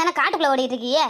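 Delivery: ordinary talk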